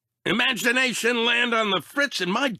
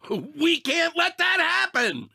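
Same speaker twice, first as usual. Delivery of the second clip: determined shout; 8 semitones higher than usual. Acted. Rallying to save Riley's world.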